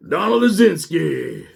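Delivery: drawn out, high and loud; mock grand introduction. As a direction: announce the name like a dreaded arrival. Mock grand entrance of a name.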